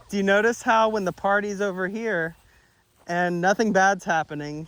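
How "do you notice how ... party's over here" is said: ordinary talk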